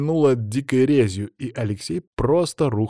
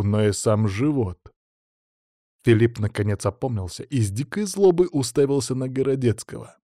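plain reading voice

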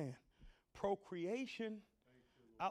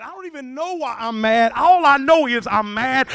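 loud, exasperated outburst; angry youth; 4 semitones higher, 20 dB louder than usual. Acted. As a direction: cry out in baffled anger. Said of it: "they've been handed over to anger".

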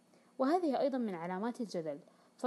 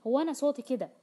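louder and higher, pushing back; exaggerated raised voice; 4 semitones higher, 6 dB louder than usual. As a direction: insist "صوتي" defensively, voice raised high. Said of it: "وسوف يبالغ في تعلية صوته". (بصوت عالٍ مبالغ فيه)

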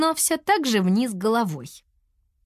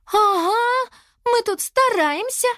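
put-on high-pitched outraged girl; indignant; 4 dB louder, slower than usual. A narrator's reading. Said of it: "возмутилась Лиана". (возмущённо, высоким голосом)

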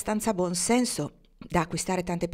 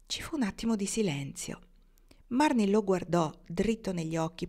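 ordinary delivery

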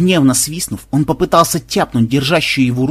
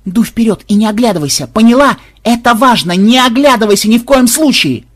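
much higher, loud shouts; urgent shouting; 9 semitones higher, 7 dB louder than usual. A narrator's reading. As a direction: yell it so she obeys. Urgent orders to granddaughter.

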